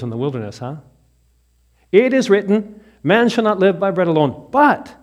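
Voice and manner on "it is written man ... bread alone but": loud, high declaration; proclaiming scripture. Retold or imitated. Jesus answering the devil, loud and proclaiming. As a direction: ring it out like a verdict.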